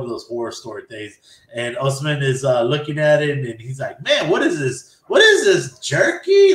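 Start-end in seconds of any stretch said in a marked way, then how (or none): 4.0-6.5 high, loud mock puzzlement; hammed-up baffled questioning, climbing higher; acted bafflement. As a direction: play someone loudly baffled by a strange object. Acting out Osman puzzled by necklace.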